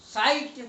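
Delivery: "साइट" with a hard t ending; correct pronunciation. Shown as a demonstration. Teacher confirming the right word, pronounced with final T. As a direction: finish "साइट" on a clear voiceless T. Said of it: "सही शब्द है".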